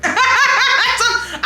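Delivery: ordinary talk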